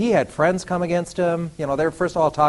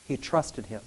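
repeated much softer and lower; soft echo; 5 semitones lower, 10 dB quieter than usual. Shown as a demonstration. Preacher echoing his point to the church, softer and lower. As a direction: let it sink in, hushed.

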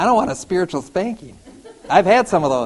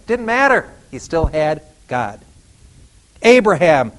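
normal talking voice

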